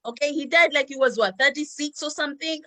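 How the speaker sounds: usual speaking voice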